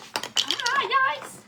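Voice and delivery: high sudden yelp of alarm; real startled exclamation; startled yikes. Real, not acted. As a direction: jump at the falling figure. Figurine falls over.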